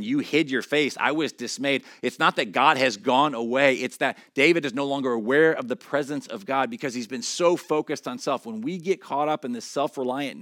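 fervent preacher explaining the psalm; impassioned preaching. Acted. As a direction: press the point on the congregation. Quoting psalm, then impassioned explanation.

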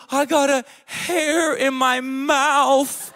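high, slow, loud wail; hammed-up tearful tantrum; mock crying child. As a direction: whine it out like a distraught four-year-old. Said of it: "doing that, that deep cry thing".

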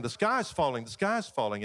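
ordinary delivery

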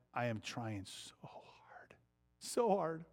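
soft unvoiced confession, then a sudden rise; whispered admission. Real, not acted. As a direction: whisper it, then lift on so hard. Admitting struggle with children.

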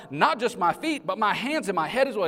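high, eager plea; eager Peter. Retold or imitated. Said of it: "Simon Peter replied".

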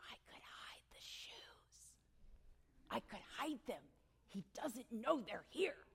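hushed secret whisper, slow. Retold or imitated. Mother tempted to hide shoes from son, slow whisper.

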